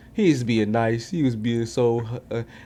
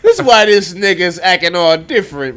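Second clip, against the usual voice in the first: loud realization; 4 semitones higher, 9 dB louder, slower than usual. Retold or imitated. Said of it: "I'm like".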